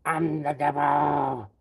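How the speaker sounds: loud, slow hammed-up devil boast. Menacing devil line.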